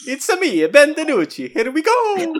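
high cartoon Italian voice; high-pitched, bouncy Italian mascot; Super Mario. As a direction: sing out catchphrases like the game plumber. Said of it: "does the Mario drop".

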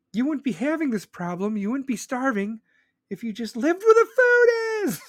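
channel Sam Kinison's rant; imitating Kinison's scream. Imitating Sam Kinison scolding the starving, ending in a high scream. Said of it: "And he screams".

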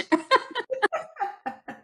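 high-pitched laughter; breaking into high laughter. Real, not acted. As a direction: laugh at your own story, up high. Laugh after the punchline.

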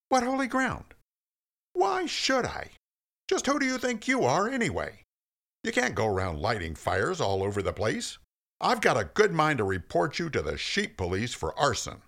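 a petty little man mouthing off; indignant squeaky Moses. A narrator's reading. Moses mouths off to the bush in a squeaky indignant voice. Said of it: "Moses said".

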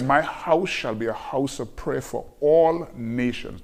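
slowed, weighty reading of the verse; scripture quoted slowly from the pulpit; slow solemn scripture quote. Acted. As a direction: read the verse slowly, every word weighed.

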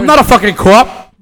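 loud, high-pitched mock protest; mock outburst. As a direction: burst out over the others in mock indignation. Comic Boston-voiced outburst over the others.

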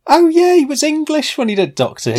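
high surprised realisation; a sudden high oh of discovery. Real, not acted. Co-host realising the actor's accent, surprised and high.

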